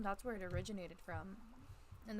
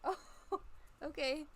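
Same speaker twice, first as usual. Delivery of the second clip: surprised; 6 semitones higher, much slower than usual. Retold or imitated. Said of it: "I was like".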